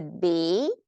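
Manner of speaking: "B" swept upward; "B" said as if asking; rising intonation. Shown as a demonstration. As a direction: glide up through a stretched "B". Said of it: "go up".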